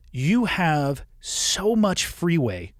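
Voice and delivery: ordinary talk, nothing marked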